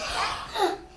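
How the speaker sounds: high mock gasp of surprise; surprised gasp. Shown as a demonstration. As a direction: gasp as if startled, for show. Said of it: "make a surprise face".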